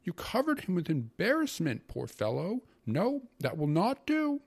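loud, high-pitched scolding rant; indignant grumbling uncle. A narrator's reading. Uncle berates his sister over the governor's dinner, indignant grumbling. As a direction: scold in a high, exasperated voice, stretching key words. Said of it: "my uncle grumbled, wrinkling up his face".

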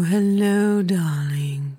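usual speaking voice

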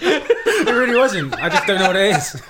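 high, loud words breaking into laughter; laughing protest. Real, not acted. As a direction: protest helplessly while really laughing. Laughing, insisting it was not planned.

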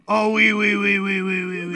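loud hammed-up French oui oui; mock French accent. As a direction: chirp it like a cartoon Frenchman. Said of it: "I could do it in French for you".

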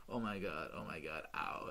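low, soft murmur of pain; dazed moaning, retold. Pitcher lying on the ground after a hit, dazed. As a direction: drop low and faint, acting knocked out.

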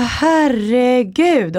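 high, drawn-out exclamation as an imitated mother; aghast exclamation. Mother telling daughter about last night, aghast. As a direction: draw it out in retold horror.

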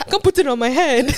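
put-on loud, high African accent. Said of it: "an African version".

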